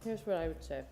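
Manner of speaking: talking between bites of food; words round a mouthful of food; eating while talking. Real, not acted. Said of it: "sorry, I'm eating".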